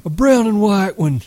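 high-pitched, eager outburst; excited cry. A narrator's reading. Lenny begging George for a pup, crying excitedly. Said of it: "Lenny cried excitedly".

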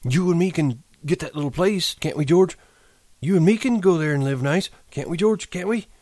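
put-on high, anxious old man; fearful pleading. A narrator's reading. Candy begs George about their little place, fearful. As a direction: plead hopefully in a high voice, afraid of the answer. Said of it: "Now Candy spoke his greatest fear".

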